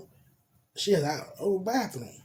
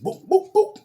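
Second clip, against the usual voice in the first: mock sound effect; 9 dB louder than usual. Mimicking the act, laughing.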